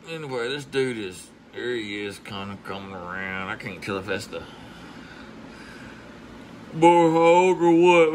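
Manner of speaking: normal talking voice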